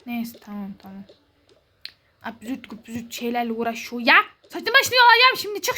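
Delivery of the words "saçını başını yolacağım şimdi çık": high, loud furious threat; angry shout. Pretend. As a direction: yell the threat at her. Acted sibling quarrel, threat.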